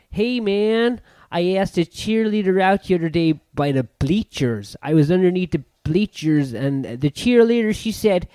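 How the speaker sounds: exaggerated American schoolboy voice; American accent. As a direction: play an American high-school kid telling his story. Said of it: "How was my accent?".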